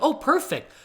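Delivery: high, delighted little exclamation; retold delight. Sophomore hearing the lady accepts them, high retold delight. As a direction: pitch up in relieved delight, retold.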